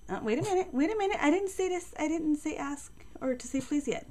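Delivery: high, startled wait-a-minute; high surprised scolding. Real, not acted. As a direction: stop the eager dog, surprised. Dog jumps the gun.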